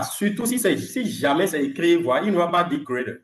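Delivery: ordinary talk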